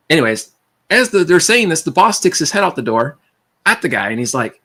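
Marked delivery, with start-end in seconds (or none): none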